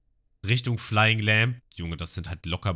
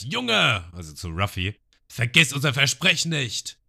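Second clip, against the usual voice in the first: Schrei von Genzo; 5 semitones higher, 4 dB louder than usual. Retold or imitated. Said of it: "wie er schreit".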